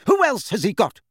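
high, loud, fierce retort. A narrator's reading. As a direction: fire back, voice right up. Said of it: "said Missus Weasley fiercely".

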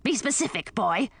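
curt, impatient order; barked. A narrator's reading. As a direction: snap at a boy like a cranky aunt. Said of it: "she barked".

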